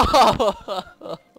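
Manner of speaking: loud high burst of laughter; giddy loud cackle. Real, not acted. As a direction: crack up loudly. Laugh after screaming.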